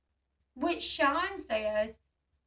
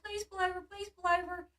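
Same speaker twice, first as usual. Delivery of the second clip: anxious pleading; 7 semitones higher than usual. Retold or imitated. Woman begging driver Sean to pull over, anxious. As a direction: repeat the plea in a small raised voice. Retelling plea as cop follows.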